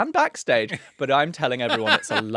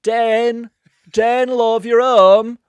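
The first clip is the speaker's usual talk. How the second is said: high, loud Yorkshire mum voice; hammed-up Yorkshire wife, high and loud; North Yorkshire wife; 10 semitones higher, 12 dB louder, much slower than usual. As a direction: greet him home like a northern cook. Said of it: "She's from North Yorkshire".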